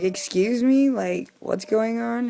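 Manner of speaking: mock-polite challenge. Loudly confronting the neighbor who yelled at her. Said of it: "and I'm like".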